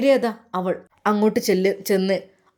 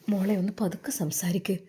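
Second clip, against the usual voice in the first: urgent whisper to the daughter; hushed whisper; 4 dB quieter than usual. Acted. Mother hushes her sulking daughter in a whisper. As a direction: whisper so the guests won't hear.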